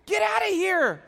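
high, loud urgent shout; mock panicked shout. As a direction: chase him off in a panic. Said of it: "And I'm like".

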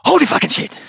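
high, loud burst of release; cursing loud and high after escaping the jaguar; relieved outburst. Retold or imitated. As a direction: let the terror explode out at once. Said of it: "and then I say".

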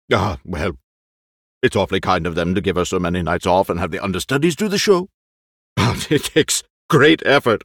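put-on fussy, anxious old actor; eccentric old actor. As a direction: play the jittery old actor. Lolo fusses, then grows wistful.

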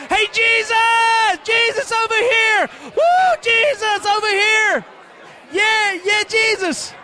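loud, high desperate yelling; shouting for Jesus. Acted. Blind beggar yelling again for Jesus over the crowd. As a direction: yell over everyone to be heard.

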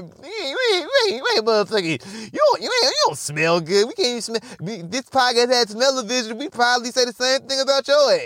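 high, indignant imitation of a listener; playful high-pitched protest; mock listener objecting. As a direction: talk back as an offended audience. Listeners objecting back at him.

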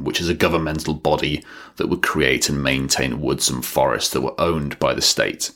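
normal talking voice

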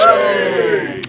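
high, airy cheer; a presenter's mock cheer over the results. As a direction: throw in a jokey hooray.

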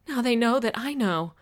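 distressed realization; rising panic. A narrator's reading. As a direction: let dread push the voice up. Panicking narrator realizes she's exposed.

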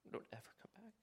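whispered echo of the command; hushed whisper. Shown as a demonstration. Jesus' command echoed in a whisper.

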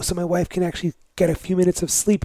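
normal talking voice

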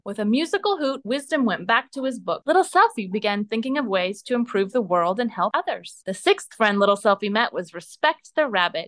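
ordinary delivery

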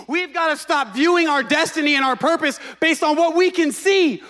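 building fervent sermon; preaching. Acted. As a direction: preach it with rising fire. Pastor preaching with rising force.

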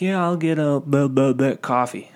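lightly voiced old guy ordering; old customer. Old guy ordering at the front counter, lightly put-on voice. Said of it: "this old, old guy".

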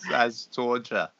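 half-hearted mock German accent; failed German accent. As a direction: try a German accent, quietly and slowly. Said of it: "I can't do a German accent".

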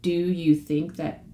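slow, softening sample question; gentle, humble. Acted. Showing how to share the gospel, gently and humbly. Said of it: "very gently and humbly say".

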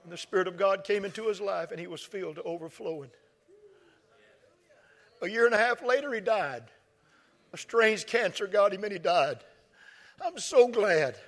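high, loud preacher's cry, stretched out; preaching at full force. Acted. Pastor crying out to the church about his friend, full-force preaching. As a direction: lift the whole house with it.

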